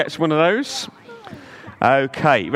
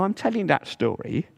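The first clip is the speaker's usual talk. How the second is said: ordinary delivery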